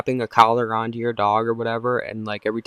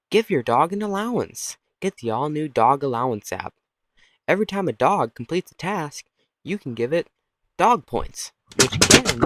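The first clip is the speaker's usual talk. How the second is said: bouncy mock commercial, swooping pitch; ad read; 3 semitones higher than usual. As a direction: pitch the app like an announcer. Mock app advert.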